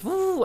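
high sliding woo like a conveyor belt; imitated whoosh. Describing the big Sainsbury's belt, a high woo sound. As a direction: mimic the belt zooming off. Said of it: "goes like".

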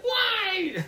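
high, loud mock wail through tears; crying child. Remembering crying at Jafar as a child, high mock sobbing wail. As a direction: whine it like a sobbing little kid. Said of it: "I was like".

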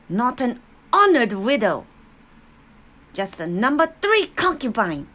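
loud, high, contemptuous scolding; shrill scolding. A narrator's reading. Grandmother scorns the returning mother, loud, high and shrill. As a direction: shame her with every word. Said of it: "Papa's shrill voice spoke".

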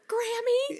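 high, rising, questioning little voice; child's meek answer. Retold or imitated. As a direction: squeak it out as a guilty kid. Child's answer to upset mom.

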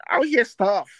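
loud retold quote; loud mock quote of her; quoted loudly. Retelling what she was saying.

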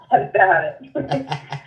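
lightly played Long Island accent. Guest echoing the host's phrase, light Long Island accent. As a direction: echo it with a touch of Long Island. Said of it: "me with my Long Island accent".

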